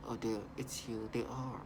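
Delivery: hushed put-on menace; creepy imitation. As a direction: voice the young woman's eerie taunt. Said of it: "this young woman also, and talking to you".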